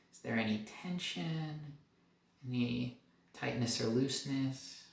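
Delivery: soft, calm meditation guide; guided meditation. Acted. Doctor leading viewers up through the body, calm and soft. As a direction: lead the body scan gently, letting pauses breathe.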